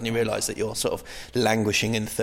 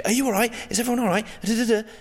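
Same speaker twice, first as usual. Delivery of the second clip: much higher, bright eager compere voice; chirpy new compere; 12 semitones higher, faster than usual. Retold or imitated. New compere greeting the audience, eager and much higher. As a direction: overeager newbie hosting the crowd. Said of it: "you do, you say".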